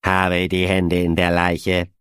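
slow, cheerful metallic synthetic voice; chirpy tinny machine voice, unhurried; Medwig tinny voice. A narrator's reading. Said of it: "in diesem munter blechernen Tonfall".

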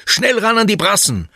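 high, loud sailing commands; high-pitched bellowing; bellowed order. A narrator's reading. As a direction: shout urgent orders across the deck. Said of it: "brüllt Oliver".

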